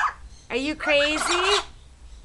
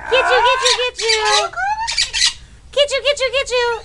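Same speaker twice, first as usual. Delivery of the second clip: high, loud sing-song "get you"; playful high teasing; 11 semitones higher, 4 dB louder, faster than usual. Pretend. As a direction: chase the bird with squeaky glee. Teasing game with pet bird.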